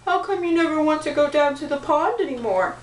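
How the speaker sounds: quick, whiny prince voice; a hint of a whiny prince; whining prince. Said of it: "whined the prince".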